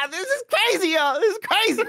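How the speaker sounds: squeaky high, laughing excited voice; hammed-up giddy falsetto; Snowbike Mike impression. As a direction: shriek it delightedly way up high. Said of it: "What about Snowbike Mike?".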